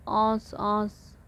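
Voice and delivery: औंस drawn out slowly with a nasalised vowel; chandrabindu nasal vowel. Shown as a demonstration. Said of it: "ऐसे चंद्रबिंदु है".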